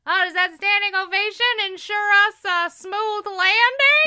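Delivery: high, loud mocking jibe; smug heckler. A heckler joking to the captain, high and smug. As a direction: needle the captain smugly. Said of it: "some guy goes".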